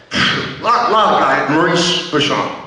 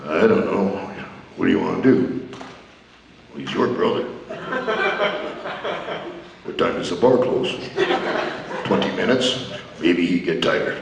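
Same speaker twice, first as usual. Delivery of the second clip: much lower quiet deadpan; low softer deadpan brothers; low mumbling wrestlers; 14 semitones lower, 8 dB quieter than usual. Retold or imitated. Brothers voiced in story.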